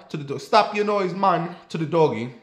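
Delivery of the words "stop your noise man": put-on high, louder, slow Jamaican-accented scolding. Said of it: "the Jamaican man said to the dog".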